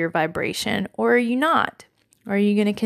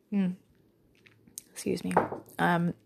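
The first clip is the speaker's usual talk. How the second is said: pausing mid-sip, voice dropping away; sipping coffee; 2 semitones lower, 7 dB quieter than usual. Real, not acted. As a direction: talk around a sip of coffee. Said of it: "sipping my coffee".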